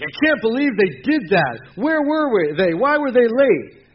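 high, loud and slow, outraged over being kept waiting; indignant complaint. Retold or imitated. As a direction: play the offended friend fuming that they came late. Acted indignant complaining quote.

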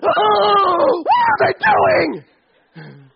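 high, loud shocked squawk; a hammed-up gasp of outrage; mock shocked God. Pastor playing a scandalized God spying on Adam and Eve, mock shrieking.

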